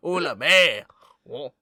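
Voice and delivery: exaggerated French accent. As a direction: gush like a film Frenchman. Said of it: "the French accent is so disgustingly stereotyped".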